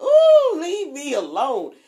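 a high loud "Ooh" then a slow grumble; grumpy drunk brush-off. Retold or imitated. Drunk woman pushing off her chipper friend, grumpy and loud. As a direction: swat the cheerful friend away. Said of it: "And I'm like".

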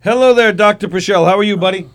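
excited greeting. Real, not acted. Greeting guest loudly on air.

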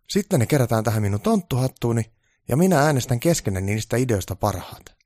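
eager to please; keen assistant voice; eager helper. Acted. Onni organises the elves.

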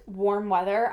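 usual speaking voice

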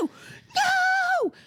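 repeated howls of refusal; furious child. Retold or imitated. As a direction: imitate a kid escalating in rage. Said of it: "so fast and so furious".

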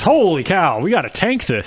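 sudden alarmed team voice; mock panic. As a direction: blurt it out in alarm. Miami voiced realizing.

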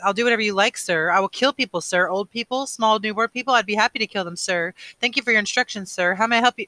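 high, loud, mock-servile rant; cruel sarcastic mimicry. A narrator's reading. Boy mimicking those who kill on orders, bitter sarcasm. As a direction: parrot obedience with savage sarcasm, unable to stop. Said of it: "mimicked in a cruel, sarcastic voice".

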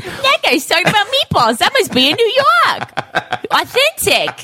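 high, dopey impressed tourist; mock tourist. Mocking gullible tourists.

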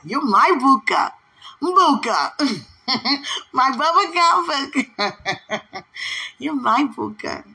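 high, drawn-out affectionate cooing; playful sweet-talk. Real, not acted. Woman teasing her beloved with pet names, playful sweet-talk. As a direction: coo playfully through laughter. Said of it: "You like when I talk like that?".

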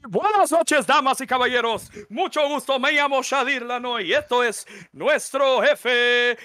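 high, loud Spanish ring announcer; lucha libre announcer. Acted. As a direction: call it out like a wrestling host. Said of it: "come up with new intros".

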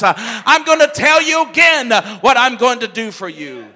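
loud, soaring preacher's cry as God; God's voice preached. Acted. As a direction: proclaim the new name with full force.

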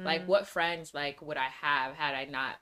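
ordinary talk

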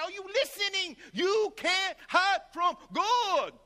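high, slow, shouted imitation of the preacher; shouted preacher warning. Imitating the preacher's slow shouted take. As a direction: bellow it slowly like a street preacher. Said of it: "He would do all these variations".

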